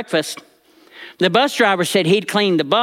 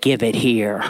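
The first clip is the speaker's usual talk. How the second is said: low, flat command from grandma; stern granny demand; 9 semitones lower than usual. Retold or imitated. Granny demanding the boy's money, low and stern. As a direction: demand it like no one says no. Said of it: "held out her hand and said".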